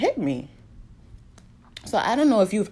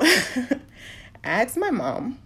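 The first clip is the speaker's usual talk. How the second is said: higher, wobbling words through tears; voice breaking; 7 semitones higher, much slower than usual. Real, not acted. Retelling asking her mom about her dad, voice breaking. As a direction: push the words out as your voice cracks. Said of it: "I'm trying not to cry".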